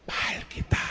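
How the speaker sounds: soft whispered words. Retold or imitated. Father whispering to his crying son, hushed. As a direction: breathe it out like a loving secret.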